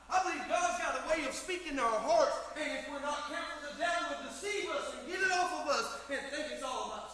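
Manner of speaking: loud, high, rising preacher's cry; full-force preaching. Acted. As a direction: preach it hard to the congregation. Preacher rises to a shout.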